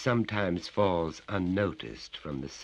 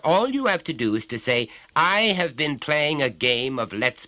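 lecturing father, raised and higher; stern father; 8 semitones higher, 7 dB louder than usual. Acted. Father lectures his little son, stern and raised. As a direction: talk down to the child from on high.

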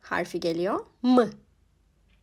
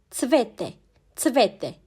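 usual speaking voice

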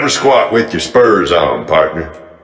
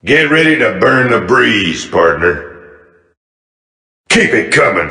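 usual speaking voice

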